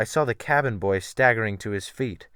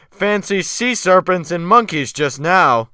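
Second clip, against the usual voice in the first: slow, loud jeering call; mock the passenger loudly to the other crew; mocking shout; 6 semitones higher, 8 dB louder than usual. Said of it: "Wolf Larsen shouted after".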